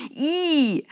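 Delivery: high drawn-out "eew" shriek; high-pitched yuck squeal; disgusted squeal. A narrator's reading. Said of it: "kreischte Rebekka".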